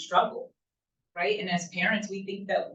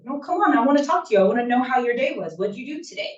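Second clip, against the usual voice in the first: put-on raised, eager parent coaxing a child; insistent coaxing; 5 semitones higher, 4 dB louder, much faster than usual. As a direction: press them playfully to open up. Acted parent pressing child to talk.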